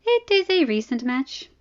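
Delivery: shrugging. Acted.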